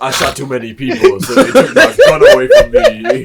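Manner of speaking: high, loud whining mock of a cop; whiny mock cop. Friends mocking Stabler losing his gun, whiny laughing voice. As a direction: whine it like a sulking kid cop with laughter.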